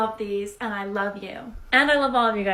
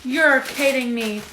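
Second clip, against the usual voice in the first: usual speaking voice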